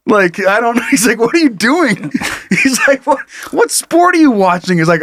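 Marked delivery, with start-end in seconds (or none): 1.2-4.8 mock baffled brother. Brother mocking in group chat.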